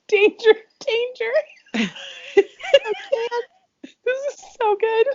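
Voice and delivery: high-pitched words squeaked out through laughter; losing it, can barely speak; helpless laughing while talking. Real, not acted. Reader cracks up mid-story.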